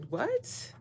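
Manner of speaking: high, soft, incredulous what; mock baffled what. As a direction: squeak it out in disbelief. Baffled at ex's question.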